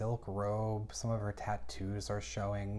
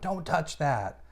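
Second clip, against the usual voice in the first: raised, higher mock outburst; freaking out; 6 semitones higher, 5 dB louder than usual. Recapper acting out Rose guarding her sea chest, freaking out. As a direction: imitate a sudden alarmed warning, pitched up. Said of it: "Rose freaks out".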